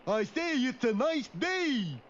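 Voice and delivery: slow, drawn-out repeat; goofy, laboured call to get through; speaking to the deaf. Acted. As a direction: spell it out for someone hard of hearing. Repeats for someone thought deaf.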